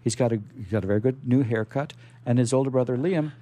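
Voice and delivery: normal talking voice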